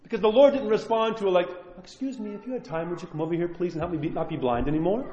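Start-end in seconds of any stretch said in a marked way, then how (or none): 1.8-5.0 soft, prim, mock-polite ask; overly polite request. Mocking a polite prayer to God, soft and prim. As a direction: ask God like a timid waiter. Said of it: "It wasn't polite".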